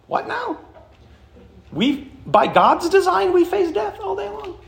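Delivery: mock a stunned listener who can't believe it; incredulous listener. Preacher voicing a baffled congregant's disbelief, pitched high. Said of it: "you should be like".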